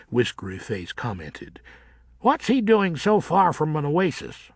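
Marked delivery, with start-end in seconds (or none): 2.2-4.5 mocking bat. A narrator's reading. Whiskery Face mocks the newcomer.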